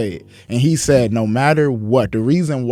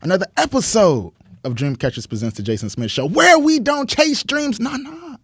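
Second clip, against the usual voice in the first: show host intro; 9 semitones higher than usual. Acted.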